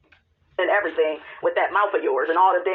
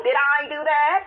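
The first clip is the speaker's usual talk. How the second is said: Steve Urkel; 6 semitones higher than usual. Retold or imitated. Jackie dodging blame as Steve Urkel, nerdy high voice. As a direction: squeak it out like a caught nerd. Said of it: "she turns into Steve Urkel".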